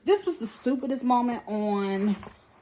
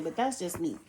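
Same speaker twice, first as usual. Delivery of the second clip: normal talking voice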